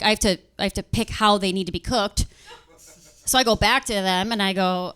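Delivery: normal talking voice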